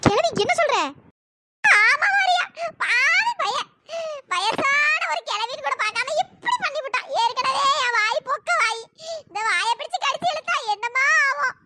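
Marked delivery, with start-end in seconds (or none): 1.6-11.5 a granny sobbing out how she was wronged; crying granny. Acted. Grandmother crying to Riya about the grandson's kiss. Grandmother wails her complaint.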